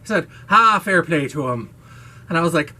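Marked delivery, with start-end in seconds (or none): 0.5-1.7 a louder imitation of an Irish accent; a playful Irish accent impression. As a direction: quote the colleague in a hammed-up Irish accent. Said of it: "one of the most Irish things".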